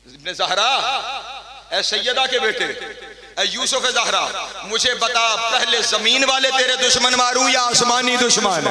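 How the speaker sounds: building from plain to a loud high cry; rising impassioned plea. Acted. Abbas asks his master, building to a loud cry. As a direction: swell into a loud fervent question.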